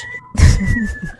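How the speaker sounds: high-pitched burst of laughing; burst of laughter. Real, not acted. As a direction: crack up at the joke. Laughs at joke about motorcycle.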